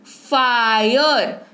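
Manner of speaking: फायर stretched slowly, "faa-yur"; slow teacher's model of फायर with a long "aay"; audible "aay" sound. Shown as a demonstration. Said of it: "आय सुनाई दे रहा है".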